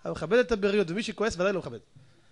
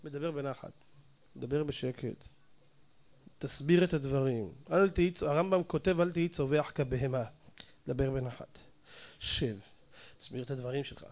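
softer, lower, gentle explaining; calm quiet speech; 7 semitones lower, 5 dB quieter than usual. Shown as a demonstration. Rabbi demonstrates speaking gently, softer and lower. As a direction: show them how a wise man speaks calmly. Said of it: "אדם שהוא חכם, מדבר בנחת".

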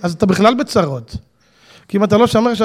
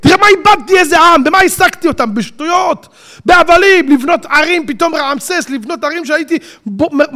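high, loud lament; lamenting Pharaoh; 5 semitones higher, 4 dB louder than usual. Acted. Pharaoh bewailing the people he let go, high lament. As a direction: moan and rant over the wasted nation. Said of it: "אומר פרעה".

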